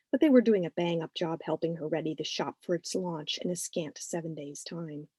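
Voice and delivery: ordinary delivery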